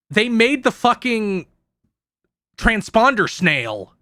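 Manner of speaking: real halt before "transponder snail" while groping for the term; searching pause. Real, not acted. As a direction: hang after "fucking", then bring out "transponder snail" slowly. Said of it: "you can hear me pause there".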